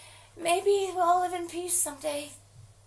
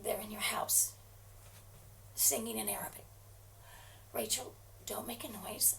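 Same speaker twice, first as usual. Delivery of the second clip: low hushed whisper; warning a friend in a hushed voice; 5 semitones lower, 10 dB quieter than usual. Acted. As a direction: keep it to a frightened whisper. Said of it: "Why are you whispering?".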